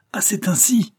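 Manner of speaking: high, loud outburst of outrage; explode, unable to hold back; furious, pitched right up; uncontained anger. A narrator's reading. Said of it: "hors d'état de se contenir".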